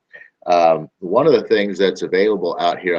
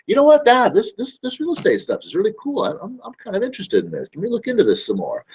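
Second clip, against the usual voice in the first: mock interested lead; 13 semitones higher than usual. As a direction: sound like a kid pleading with Dad. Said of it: "they're like".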